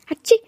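high, loud sneeze; a sudden sharp sneeze; acted sneeze in the middle of the talk. Florentine sneezes.

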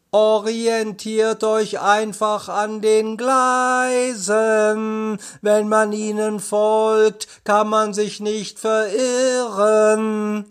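slow, high, drawn-out call; calling from afar. A narrator's reading. Justus calling to friends in the tunnel, drawn-out calling. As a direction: call down the tunnel, stretching the words. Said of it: "Plötzlich hörte er Justus Stimme".

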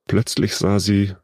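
usual speaking voice

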